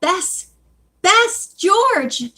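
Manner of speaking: high, urgent calling out; shouting. A narrator's reading. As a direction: call to friends for help. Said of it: "she shouted".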